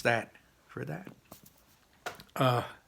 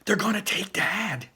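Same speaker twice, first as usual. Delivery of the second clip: high, urgent horror, more stage whisper than hush; horrified; 10 semitones higher, 5 dB louder than usual. Acted. Ian to his brother about Dad, horrified. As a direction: gasp it out in high-pitched alarm. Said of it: "Ian whispered, horrified".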